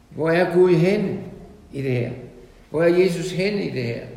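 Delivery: loud rhetorical questions. Acted. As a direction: challenge the congregation at full voice. (højlydte, indtrængende spørgsmål)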